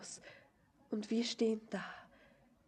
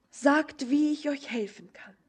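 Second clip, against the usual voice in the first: urgent plea; 4 semitones higher, 8 dB louder than usual. Acted. Pleading for an answer.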